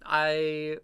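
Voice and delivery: ordinary delivery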